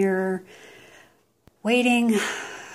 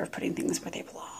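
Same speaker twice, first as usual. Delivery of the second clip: whispered aside; 10 dB quieter, faster than usual. Real, not acted. Unvoiced quick aside.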